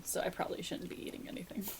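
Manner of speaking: fast hushed confession; whispering. Real, not acted. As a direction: murmur it guiltily, quickly. Whispered aside.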